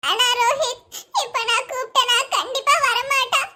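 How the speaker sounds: ordinary delivery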